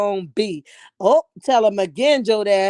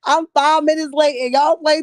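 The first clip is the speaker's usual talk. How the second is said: loud and high, fed up with the chat; half-laughing exasperated scolding; exasperated outburst; 6 semitones higher, 7 dB louder than usual. Real, not acted. Host loudly scolds her playful chat, exasperated.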